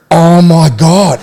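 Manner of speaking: slow, loud drawn-out oh my God; mock shocked gasp. Telling podcast mates about the kiss, a loud slow shocked oh my God.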